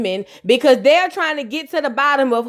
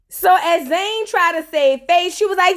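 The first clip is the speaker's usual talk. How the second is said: normal talking voice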